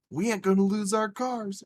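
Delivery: high, triumphant delivery; retold elation. Retold excited news to mom.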